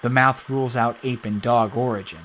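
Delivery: usual speaking voice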